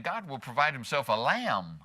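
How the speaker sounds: lamb stretched out at the end; long, weighted final word lamb; stress on LAMB. Shown as a demonstration. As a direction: let the emphasis fall on lamb. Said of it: "No matter how you emphasize it".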